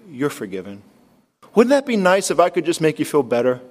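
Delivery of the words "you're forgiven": much softer, gently spoken; soft pardon. Acted. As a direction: say it like a tender, hollow comfort. Pastor pardoning a visitor.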